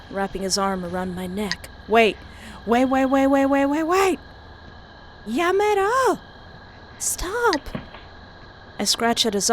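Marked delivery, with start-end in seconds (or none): 1.9-4.2 rising, louder panicked pleas; escalating pleas to be let go; panicked wait. Acted. Pleading with Patrick to let go, rising panic. 5.3-7.6 high cry to stop. Acted. Begging Patrick to stop, high desperate cry. Struggling in his grip.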